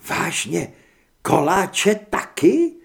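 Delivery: "koláče taky?" slow, high and loud, rising on "taky"; slowed, drawn-out, delighted "koláče taky?"; amazed disbelief. Acted. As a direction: stretch out "koláče taky?" in wonder. Heather checking pie too.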